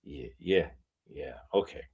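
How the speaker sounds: low, soft, doubtful acknowledgement; subdued acceptance. Retold or imitated. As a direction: play it meek and unconvinced, almost under the breath. Acting out own doubtful, subdued reply.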